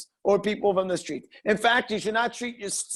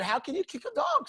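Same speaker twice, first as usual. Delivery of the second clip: indignant objector; 10 semitones higher than usual. Retold or imitated. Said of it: "some people here disagree with me".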